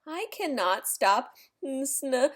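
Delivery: plain reading voice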